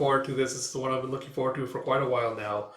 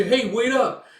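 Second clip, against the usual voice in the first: high, loud flustered calling; nervous elf; 10 semitones higher, 7 dB louder, slower than usual. Acted. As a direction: call after him, flustered. Elf chases after Guts.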